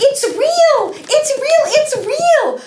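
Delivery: very high, loud excited squeal; thrilled squeal. Acted. Rose Red squealing after poking the real bear. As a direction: shriek it with delight.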